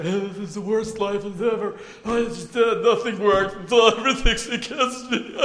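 loud, high, hammed-up wail; mock wailing complainer. Preacher mimics scores of people lamenting their lives, mock wailing. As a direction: whine it loudly, as if everything were a catastrophe.

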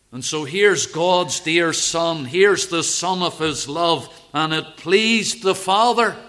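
loud, lifted preaching voice; preaching at full force. Acted. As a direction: proclaim it to the congregation.

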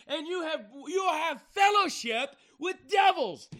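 loud, high-pitched shouted rebuke from the pulpit; full-force preaching. Real, not acted. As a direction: thunder at the church to rouse it.

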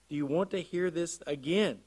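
usual speaking voice

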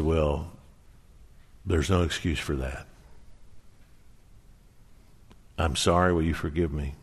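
low, subdued apology with long pauses; retold humble apology, pitched well down; contrite. Husband asking wife's forgiveness, contrite.

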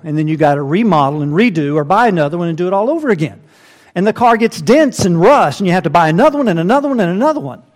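exasperated preacher in full flow; building sermon climax. Acted. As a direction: preach it hard, rushing toward the end.

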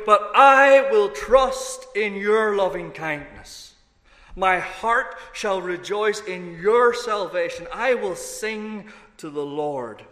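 loud, high, slow cry of trust; crying out to God. Retold or imitated. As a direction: lift it up to God in full voice. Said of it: "David cries out to God".

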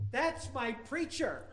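high, drawn-out acted announcement; mock exclamation. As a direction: exclaim it slowly in a put-on high voice. Someone points out preacher in story.

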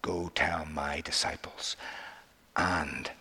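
slow near-whisper, pausing before "Peter"; hushed, weighty instruction. Acted. Imagined Lord telling the angel to include Peter, hushed. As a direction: whisper it slowly, then voice "Peter". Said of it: "this is very important".

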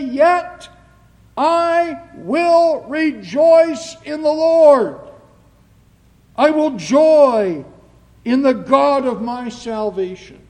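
slow, high, very loud proclamation; big pulpit declamation, each word drawn out; triumphant scripture reading. Acted. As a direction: declare the prophet's joy at full force. Preacher proclaims the prophet's verse.

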